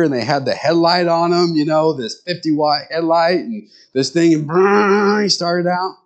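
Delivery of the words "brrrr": high buzzing motor whirr; sustained brrr like a roaring motor; imitated vacuum noise. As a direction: imitate an old vacuum revving up. Imitates an old vacuum cleaner starting.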